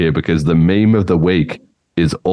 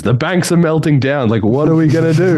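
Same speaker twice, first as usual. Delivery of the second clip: panicked banker; 11 semitones higher than usual. Retold or imitated. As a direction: play the frantic banker asking what to do. Voicing panicked bankers in clip.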